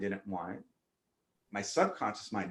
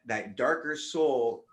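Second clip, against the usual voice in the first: normal talking voice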